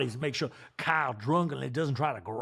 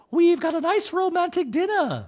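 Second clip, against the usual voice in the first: much higher, louder sing-song wife voice; a doting wife, pitched way up; Dorit impression; 5 dB louder than usual. Wife nagging husband about salad, high mock voice. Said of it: "And Dorit's like".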